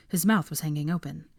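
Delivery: ordinary delivery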